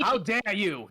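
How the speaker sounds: mock demand. A narrator's reading. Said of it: "demanded Professor Snape".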